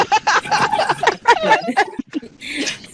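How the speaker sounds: long high-pitched laugh. Real, not acted. Laughing at her memory.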